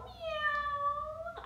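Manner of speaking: high soft meow; teacher meowing softly for children; kitty meow. Shown as a demonstration. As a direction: mew like a little kitten. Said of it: "kitty cat".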